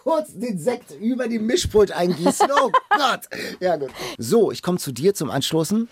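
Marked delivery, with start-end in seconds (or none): none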